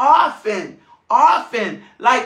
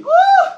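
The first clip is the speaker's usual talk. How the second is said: high, loud whoop of excitement; joyful woo; 9 dB louder than usual. Real, not acted. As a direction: let out a big preacher's whoop. Preacher's exclamation in full flow.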